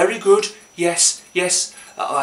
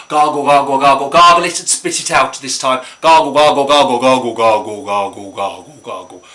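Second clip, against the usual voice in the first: gargling chant; 5 semitones lower, 6 dB louder than usual. Pretend. Showing the child how to gargle, chanting. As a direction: chant it like gargling, winding down in pitch.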